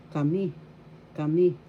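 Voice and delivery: Kami with the accent on MI; stress on second syllable. Shown as a demonstration. Teacher shows learners the accent, second syllable of Kami stressed. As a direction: punch the final MI in Kami. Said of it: "Second syllable has the accent".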